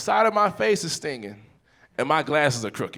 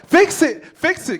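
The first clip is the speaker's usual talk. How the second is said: high, loud frantic plea; hammed-up panicked toddler; imitated panicking child; 12 semitones higher, 8 dB louder, slower than usual. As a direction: mimic a small child panicking, pitched way up. Daughter panicking over broken face.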